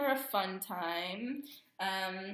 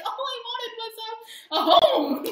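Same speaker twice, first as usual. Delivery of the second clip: mock whine; 12 semitones higher, 9 dB louder, slower than usual. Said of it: "and you'll be like".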